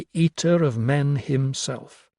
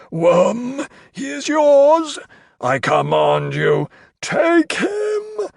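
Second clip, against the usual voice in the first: slow summons climbing to a high shriek; frantic command; 12 semitones higher, 5 dB louder, much slower than usual. A narrator's reading. Carstairs gives Crow to the Worm, shrieking command. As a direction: sic the monster on him.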